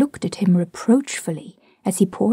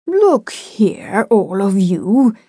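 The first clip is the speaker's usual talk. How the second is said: drawn-out, coaxing appeal; entreating; much slower than usual. A narrator's reading. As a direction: plead for everyone's attention. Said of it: "he said entreatingly".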